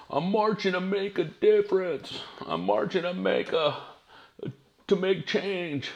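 high, slow mocking voice of a protester. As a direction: mimic a self-important protester, pitched high. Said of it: "there's people out there, you know".

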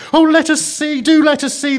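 very high, loud pleading; excited children's cry. A narrator's reading. Children beg the girl to show them, excited cry. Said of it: "the children cried both at once".

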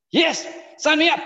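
excited, high-pitched mock yes; eager acceptance. Student accepting Mandela's invitation, excitedly. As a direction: jump at the offer with eager, high energy.

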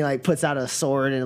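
ordinary delivery

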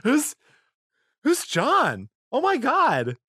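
high-pitched pretend panic; mock hysterics. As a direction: play along as a distraught jealous lover, way up high. Second host joins jealous snooper act.

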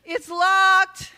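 high, loud, drawn-out call; shout. A narrator's reading. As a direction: shout it out with LOCKED stretched long. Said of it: "I shout".

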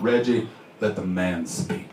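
put-on slow, low old-timer voice; wise old man. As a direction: step in slowly and low, like an elder.

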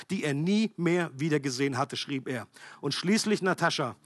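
lively read-aloud of a harrowing testimony; story reading. Acted. Preacher reads a persecution story to the congregation, lively narration. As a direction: read it out like a gripping story to the church.

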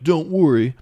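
loud, high mock battle cry; rally the gang like a cartoon kid hero; put-on heroic kid bravado; gung-ho kids. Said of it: "the kids are like".

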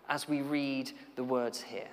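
usual speaking voice

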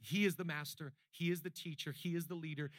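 usual speaking voice

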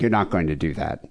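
imitating Dad, low and stern; lightly voiced no-nonsense father; super serious dad. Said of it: "super serious".

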